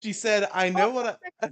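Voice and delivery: trying to read through laughter; laughing. Real, not acted. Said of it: "laughing through the line".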